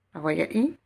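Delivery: ordinary talk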